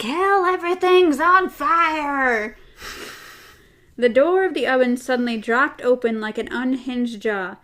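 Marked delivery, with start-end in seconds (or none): 0.0-2.6 louder, higher, slowed mock alarm; panicked yell. A man bursts into the room yelling, hammed-up panic. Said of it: "someone busts into the room and is like".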